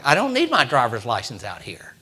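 ordinary talk

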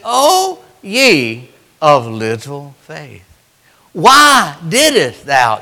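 slow, drawn-out lightly voiced rebuke; a hint of Jesus chiding them, stretched slow; Jesus rebuking. Jesus rebuking the disciples, drawn out slowly. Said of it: "He said".

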